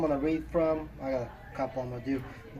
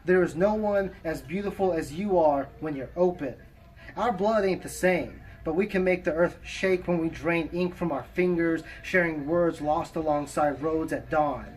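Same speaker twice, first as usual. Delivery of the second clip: poem recitation; 3 semitones higher, 5 dB louder than usual. Acted. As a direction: recite it with measured pauses between phrases. Reads own poem aloud.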